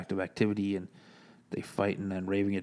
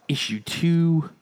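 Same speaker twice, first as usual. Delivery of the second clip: drawn-out hype; 8 semitones higher, 7 dB louder, slower than usual. Real, not acted. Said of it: "hyped title read".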